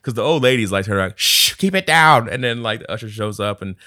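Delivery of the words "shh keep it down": loud, high, slow shushing; mock loud shush. Old lady in the theater loudly shushing the couple. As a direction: shush them hard and drawn out.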